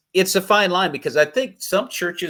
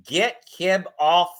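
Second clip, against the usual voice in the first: quoting his wife; 6 semitones higher, 5 dB louder, much slower than usual. Retold or imitated. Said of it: "got about this far away from my nose and said".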